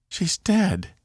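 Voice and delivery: high-pitched, stunned disbelief; shocked grief. Acted. Laura repeats to herself that the captain is dead, shocked. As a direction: say it like you can't take it in.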